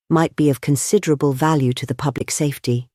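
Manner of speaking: usual speaking voice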